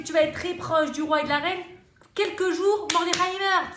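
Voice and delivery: high, loud excited plotting; scheming wife voice. Acted. Zeresh urging her husband Haman, high, loud and scheming. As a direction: egg him on gleefully.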